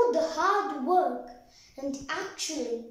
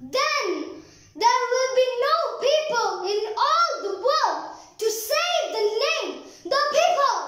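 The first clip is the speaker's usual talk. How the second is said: rousing climax; 6 semitones higher than usual. Acted. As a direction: proclaim it at full pitch to the crowd. Climax of the recited poem.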